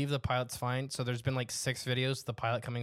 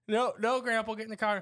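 higher-pitched imitation of exasperated family; mock pleading with an old man; urging grandpa; 8 semitones higher than usual. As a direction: hurry a wayward grandpa along. Acts family hurrying grandpa along.

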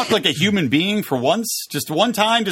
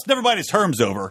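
ordinary talk, nothing marked

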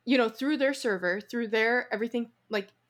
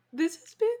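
teary laugh; 7 semitones higher than usual. Real, not acted. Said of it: "I don't wanna cry on the podcast".

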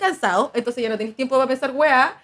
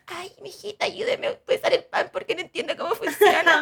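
lightly voiced old lady asking for help, a touch higher; 6 semitones higher than usual. Granny asking a girl at the supermarket for help, lightly voiced. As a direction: play a sweet old granny, just a hint. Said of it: "una viejita pita".

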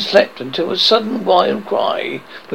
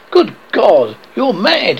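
louder, shocked exclamation; aghast; 6 semitones higher, 4 dB louder, slower than usual. A narrator's reading. Husband hearing his wife's plan for the wish, aghast. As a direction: exclaim in horror, voice rising. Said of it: "he cried aghast".

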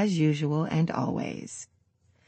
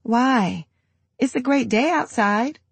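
joking; 7 semitones higher than usual. Acted. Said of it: "I joke".

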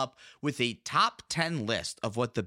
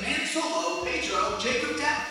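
high, drawn-out name roll call; announcer read; 10 semitones higher than usual. Acted. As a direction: call out names like an emcee. Reads patron names in voice.

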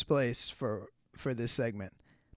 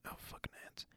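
whispered aside; 20 dB quieter, faster than usual. Real, not acted. Aside while pulling clip up.

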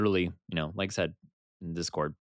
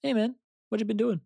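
Korg impression; 14 semitones higher than usual. Pitching a Korg collab to the cohost, high Korg voice. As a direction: do a gentle, friendly Korg. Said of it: "love to see him collab with Korg".

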